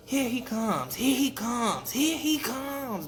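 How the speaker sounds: high, sing-out warning cry; imitating frogs sounding the alarm; mimicked frog call. A narrator's reading. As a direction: call it out high, stretching the last one.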